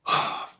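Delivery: long, audible acted sigh. A narrator's reading. Policeman finally giving in to Jack, sighing. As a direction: let out a weary policeman's sigh.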